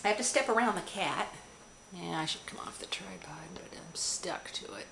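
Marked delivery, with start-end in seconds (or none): 1.9-4.9 soft whispered aside to camera; under-the-breath whisper while moving. Real, not acted. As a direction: whisper it as a quick confession. Aside while stepping round cat.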